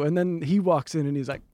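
normal talking voice

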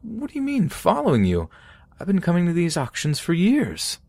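put-on high, startled old man; a squeaky startled denial; defensive old man. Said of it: "back to that quiet monotone".

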